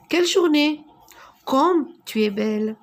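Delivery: voix montante et forte, très expressive; exclamation marquée. Shown as a demonstration. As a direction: lancer l'exclamation avec élan. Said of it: "exprimer un sentiment". (exclamation enthousiaste)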